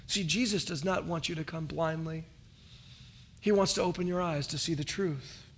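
usual speaking voice

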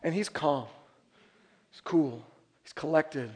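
low, soft, evenly spaced phrases; cool, hushed composure; calm and collected. Shown as a demonstration. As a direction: drop to unruffled quiet after the panic. Contrasts dad's calm with screaming.

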